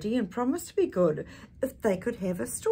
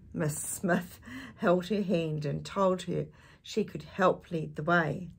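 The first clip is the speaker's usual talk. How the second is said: ordinary delivery, nothing marked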